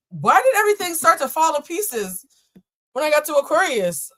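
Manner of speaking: high, loud complaint; exasperated outburst. Real, not acted. Reader whose setup falls apart at Aquarius, exasperated outburst. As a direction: cry out in rising alarm. Things topple during the reading.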